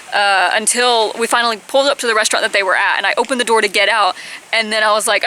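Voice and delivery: ordinary talk, nothing marked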